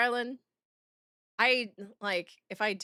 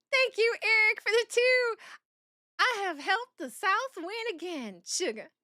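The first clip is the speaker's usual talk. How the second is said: put-on Southern Belle accent; 9 semitones higher than usual. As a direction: play a coy Southern Belle thanking a viewer. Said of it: "I will read it with the Southern Belle accent".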